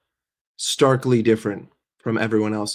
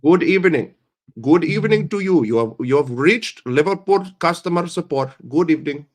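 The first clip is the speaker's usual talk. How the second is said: put-on higher, louder Indian accent; 5 semitones higher, 5 dB louder than usual. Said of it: "Imagine me saying it in Indian accent".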